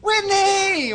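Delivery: loud, high call across a driveway; yelling. Retold or imitated. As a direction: holler the name, stretched out. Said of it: "turns around and yells to the".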